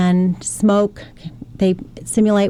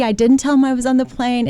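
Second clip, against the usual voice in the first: ordinary talk, nothing marked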